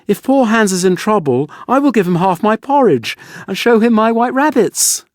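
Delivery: put-on a small boy pleading for his friend; the youngest son. A narrator's reading.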